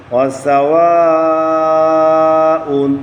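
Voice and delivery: ওয়াসাওয়াউন drawn out slowly, syllable by syllable; teacher models ওয়াসাওয়াউন slowly for the class; slow model recitation. Shown as a demonstration. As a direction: stretch ওয়াসাওয়াউন clearly for learners to copy. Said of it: "সবাই ধরেন".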